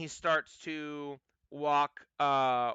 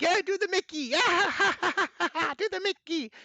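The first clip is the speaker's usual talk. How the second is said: high cackling gnome chant; jeering red caps; 3 dB louder, faster than usual. Acted. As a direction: taunt him with high squeaky laughter. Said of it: "and they're like".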